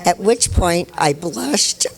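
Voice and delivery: ordinary talk, nothing marked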